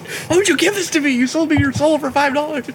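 very high, squeezed voice, hammed-up reproach; mock anguished plea. The voodoo victim to the stick buyer, mock anguished plea. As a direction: plead like a comically wronged victim.

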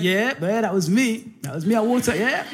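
lightly acted, offhand humble brag; Peter. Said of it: "And he'll be like".

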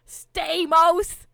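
shout. Retold or imitated. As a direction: yell it out like a desperate plea. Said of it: "he literally shouts".

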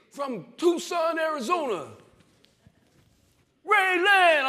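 imitating a loud, high, slow sports announcer. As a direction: boom it out like the TV intro calling his name. TV announcer voiced in story.

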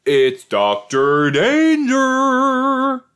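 big, high, drawn-out shout of the name; excited announcement. Acted. Streamer hyping up a returning character, dramatic announcement. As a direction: announce him like a game-show host.

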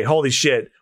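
ordinary delivery, nothing marked

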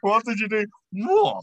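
high, loud disbelieving shout; shocked disbelief. Real, not acted. Friend's shocking story reaction.

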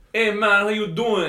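imitating a Jamaican street seller, high and loud; a light Jamaican accent, friendly greeting. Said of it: "he had a Jamaican accent".

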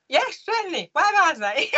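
jolly big man being kind; kindly head teacher. Retold or imitated. Head teacher kindly answering the timid girl. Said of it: "He went".

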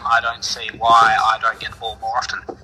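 ordinary talk, nothing marked

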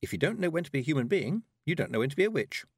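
quick, high, chirpy quoting; cheerfully. A narrator's reading. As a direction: rattle the saying off cheerfully. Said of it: "said Tiffany cheerfully".

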